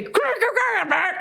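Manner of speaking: high playful growling grr through a bit; pony growl. Retold or imitated. Pony with a bit in her mouth, high mock growl. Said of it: "as I'm about to say".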